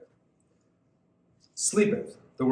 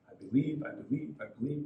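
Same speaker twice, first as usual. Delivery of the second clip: softening plea; 8 dB quieter, faster than usual. Acted. Repeating the father's plea.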